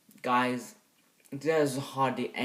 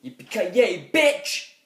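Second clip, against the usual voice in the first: much higher, louder hammed-up action-hero yell; catchphrase shout; 7 semitones higher, 10 dB louder, slower than usual. Reviewer doing the hero's catchphrase as a hammed-up shout. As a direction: bellow the catchphrase, rising to a squeal on bitch. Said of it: "he was just like".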